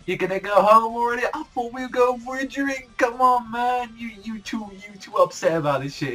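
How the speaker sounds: high, loud mocking taunt; high cocky party call to the sulking captains; imitating Yami. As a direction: tease the leaving captains like a cocky drunk. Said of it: "he's just like".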